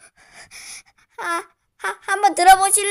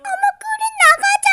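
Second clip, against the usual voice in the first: much higher put-on sister voice; sister impression. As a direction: answer in the sister's high voice. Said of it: "우리 언니 성대모사 했어요".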